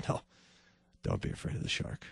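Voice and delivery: breathy whispered reassurance. Acted. The Lord whispering to us about the shark. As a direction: whisper it close, no voice.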